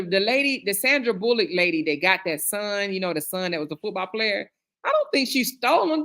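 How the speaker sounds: normal talking voice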